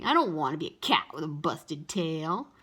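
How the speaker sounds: put-on lightly sulky boy voice; complaining. A narrator's reading. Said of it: "complained Howie".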